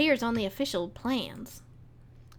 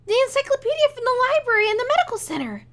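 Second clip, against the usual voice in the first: high, loud, quick excitement; eager eureka outburst; sudden realization; 8 semitones higher, 4 dB louder than usual. Acted. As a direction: blurt out the idea as it clicks. Sudden realization about the puzzle.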